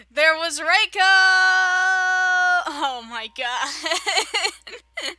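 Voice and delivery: loud, shocked outcry, drawn out; a real startled yell at the screen; startled shout. Real, not acted. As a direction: blurt it out in shock. Spots naked Reiko in spring.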